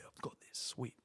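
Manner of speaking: whispered, very soft; a nervous kid bluffing; mock timid whisper; timid boy. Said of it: "And he's like".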